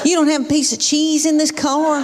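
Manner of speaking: sulky demands from the back seat; bratty preteen. Retold or imitated. Eleven-year-old griping at mom from the back seat, lightly voiced whiny brat. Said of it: "Every day she says something like this".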